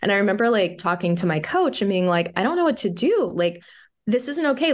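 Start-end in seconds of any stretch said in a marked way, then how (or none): 2.4-4.7 high-pitched helpless venting; imitated worked-up complaint; retold distress. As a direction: act out fretting to a coach. Retold venting to her coach.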